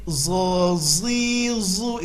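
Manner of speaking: zo zi zu with the tongue kept in, sounding z; wrong dza as zo. Shown as a demonstration. Teacher showing the thick zal mistake. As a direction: say zo zi zu without the tongue out. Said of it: "Itu huruf zal yang ditebalkan".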